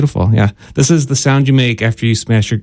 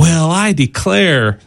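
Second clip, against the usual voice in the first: slow, stretched southern exclamation; southern accent; 4 semitones higher, much slower than usual. Acted. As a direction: play it southern, higher and drawn out. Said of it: "Why is your voice getting southern sounding?".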